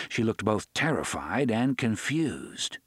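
ordinary delivery, nothing marked

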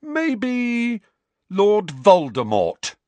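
high drawn-out guess turning loud; sarcastic suggestion building to defiance; mock thoughtful. A narrator's reading. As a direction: stretch the pretend guess, then hit the name hard.

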